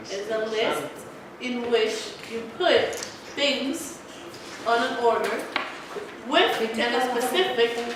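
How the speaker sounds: slow, phrase by phrase with pauses; deliberate dictation pace, broken by pauses. Shown as a demonstration. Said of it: "Okay, I'll say it slower".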